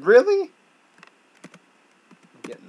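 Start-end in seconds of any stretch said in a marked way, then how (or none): 0.1-0.5 high, loud "really"; disbelieving outburst; exasperated disbelief. Real, not acted. Gamer dying again, loud disbelief. Dies again after long attempt.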